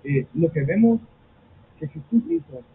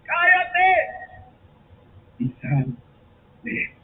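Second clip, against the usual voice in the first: high, loud rebuke on Cállate, then settling; mock stern order, hammed-up on the first word; 12 semitones higher, 3 dB louder, slower than usual. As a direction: command the spirit to be silent. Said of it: "Jesús los reprendió diciendo".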